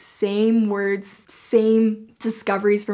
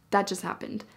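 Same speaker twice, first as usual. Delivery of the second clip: ordinary talk, nothing marked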